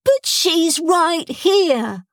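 high, drawn-out protesting wail; slow, plaintive child's protest up high. A narrator's reading. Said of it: "wailed Stront".

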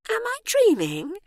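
very high, wondering diva voice; bewildered starlet. A narrator's reading. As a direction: ask it high-pitched, in disbelief. Bewildered starlet questions her surroundings.

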